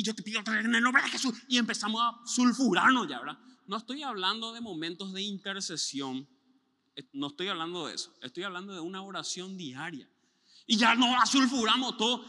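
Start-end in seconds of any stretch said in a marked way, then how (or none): 0.0-3.1 louder, higher, worked up; heated mock prayer. Imitating a worked-up believer praying in a rush. 10.7-12.2 louder, higher; heated outburst. Retold or imitated. Describing believers getting worked up in prayer, loud.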